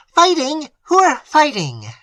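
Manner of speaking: high, loud shocked lady; alarmed cry. A narrator's reading. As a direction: cry out at the news. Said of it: "cried Madame Montoni".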